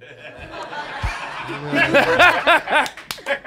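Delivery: loud, high burst of laughter. Real, not acted. Sue cracking up at her own joke, loud laughter. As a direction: laugh hard at the punchline.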